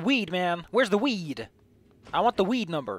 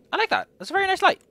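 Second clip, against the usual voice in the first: much higher, gushing over a nice light; delighted; 7 dB louder, faster than usual. Real, not acted. Player admiring a lamp design, high delighted gush. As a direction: squeal with pleasure at a pretty lamp.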